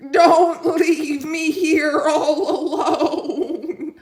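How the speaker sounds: high, loud, slow wail; pleading sheep. Acted. Sheep wailing after the departing tractor. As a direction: beg not to be left behind.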